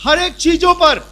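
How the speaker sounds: loud, high, ringing sermon; forceful preaching. Acted. Preacher tells the congregation what God made them, loud and fervent. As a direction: proclaim it triumphantly.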